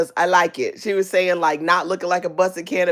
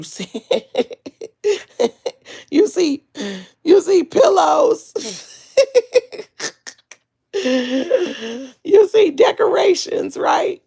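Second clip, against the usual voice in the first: laughing; 9 semitones higher, slower than usual. Real, not acted. Giggling while listing Peach's things.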